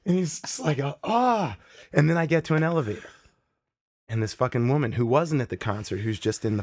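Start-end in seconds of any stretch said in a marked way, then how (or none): 0.8-1.6 high, frantic little cries of fright; mock-panicked ah ah while running off; a guy's hammed-up scared yelps. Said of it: "freaks out and starts running".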